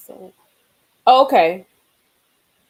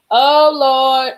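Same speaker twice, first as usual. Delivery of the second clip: dismayed; 6 semitones higher, 9 dB louder than usual. Real, not acted. Reacting to Candy's post.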